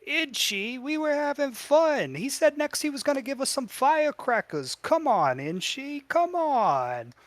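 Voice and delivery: put-on very high-pitched childish protest; whining goblin kids. Goblin kids begging Inchi not to spoil fun, whining. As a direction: whine and plead like a let-down kid.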